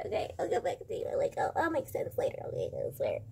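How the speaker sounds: whispered. Real, not acted. Whispered wrap-up.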